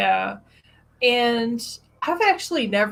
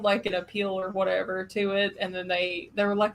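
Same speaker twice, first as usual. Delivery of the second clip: ordinary delivery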